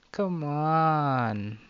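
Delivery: high, drawn-out wheedling whine; whining. A narrator's reading. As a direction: whine it long and loud, pleading. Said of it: "Lena whines".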